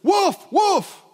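two high, loud barked cries; shouted warning. Shown as a demonstration. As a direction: call out the danger to your teammate. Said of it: "A good team player says".

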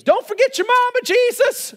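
very high, loud, giddy squeal; giddy woman. Retold or imitated. Giddy woman in the crowd teasing Jesus, squealing high. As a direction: blurt it out like an overexcited fan. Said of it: "this lady just got giddy and goes".

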